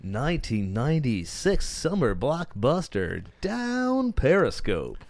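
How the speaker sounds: slow dramatic trailer read; movie trailer voice. Acted. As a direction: draw out the title like a trailer. Trailer-style title read.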